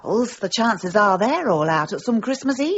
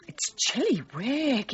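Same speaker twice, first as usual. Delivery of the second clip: usual speaking voice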